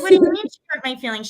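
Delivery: high, loud, fast retort; acted incredulity. Mother questions her crying daughter, acted incredulity. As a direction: fire it back in mock disbelief.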